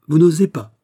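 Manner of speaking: high, loud exasperated retort. A narrator's reading. Princess to courtier who dares not speak, exasperated. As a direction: fling it back in exasperation. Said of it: "dit madame exaspérée".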